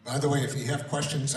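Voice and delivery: ordinary talk, nothing marked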